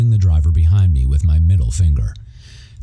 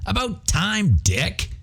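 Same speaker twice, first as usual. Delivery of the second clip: irritated driver yelling, pitched much higher; angry commuter yelling while driving by; passing shout; 13 semitones higher, 6 dB quieter, slower than usual. Acted. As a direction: bark it out a car window in passing. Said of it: "came the shout from the slightly pissy Angelino".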